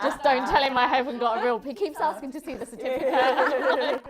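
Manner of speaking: loud, laughing brag. Real, not acted. Laughing while joking to the host about Doctor Sasha. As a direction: giggle through the cheeky boast.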